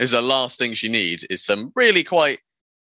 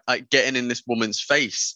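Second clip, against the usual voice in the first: ordinary talk, nothing marked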